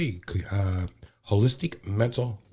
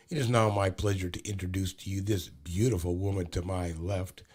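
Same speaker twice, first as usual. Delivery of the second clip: ordinary delivery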